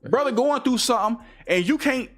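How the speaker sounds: heated rant. Real, not acted.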